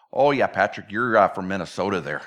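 low-pitched mock Minnesota accent. People spotting where Patrick is from, Minnesota accent. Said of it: "tell where they're from as soon as you hear them talk".

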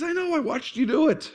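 high, light grandmother voice; a hint of an amused grandma; gentle grandma. Grandma telling the boy she saw it, lightly voiced. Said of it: "She says".

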